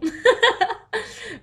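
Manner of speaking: high, loud real laugh bursting out. Real, not acted. After blowing kisses to a TV presenter, high loud laughter.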